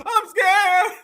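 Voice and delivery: high, whimpering fake fear; hammed-up scared wail; mock scared cry. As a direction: imitate a frightened man, making fun. Mocks the scared man in clip.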